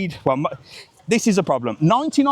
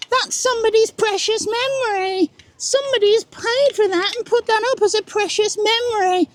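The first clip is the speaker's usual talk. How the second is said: much higher, sarcastic whiny voice; mocking the critics. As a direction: parrot the moaners in a silly high voice. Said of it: "you lot bloody hate me for doing it".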